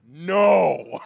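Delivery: a sudden, loud no; emphatic shout. Acted. Pastor shouts his answer about daughter's suitors, loud. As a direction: bark the refusal.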